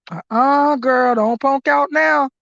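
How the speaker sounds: usual speaking voice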